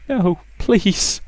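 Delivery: put-on high, timid girl's plea; a scared daughter daring to refuse; frightened girl. Rosa telling her mother she won't kill, timid high plea.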